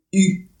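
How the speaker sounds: "u" said as the French rounded vowel, lips forward; a loud, careful French "u"; French u sound. Shown as a demonstration.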